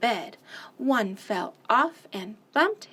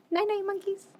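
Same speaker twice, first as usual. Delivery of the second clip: higher and softer, tender; tender goodnight; 10 semitones higher, 4 dB quieter, faster than usual. Real, not acted. Parent saying goodnight to the child at bedtime, soft and tender. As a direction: coo it gently as the lights go out.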